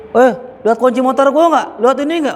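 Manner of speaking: a hammed-up shout looking for keys; mock shout. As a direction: yell for a lost motorbike key. Shouting for lost keys in mosque.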